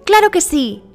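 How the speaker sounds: put-on high-pitched, eager child voice; high little-girl voice; cheerful little girl. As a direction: agree brightly as a little girl.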